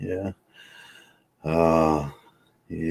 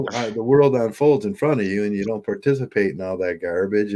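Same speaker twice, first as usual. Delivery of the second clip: ordinary delivery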